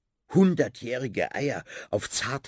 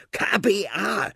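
K B A said slowly and high, letter by letter; spelled out slowly; 10 semitones higher, 4 dB louder than usual. Acted. Rat spelling the letters out slowly for Finn. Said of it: "wiederholt die Leseratte".

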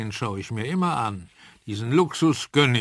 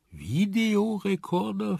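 langsam, hoch und leiser ungläubig nachgefragt; verwundert fragend; 8 semitones higher, slower than usual. A narrator's reading. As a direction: das fremde Wort ungläubig nachfragen. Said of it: "fragte der Professor". (verwunderter Professor fragt nach dem Videorekorder)

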